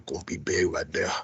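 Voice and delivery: a low, whispered Southern twang; mock Southern accent. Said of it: "it's my Southern accent".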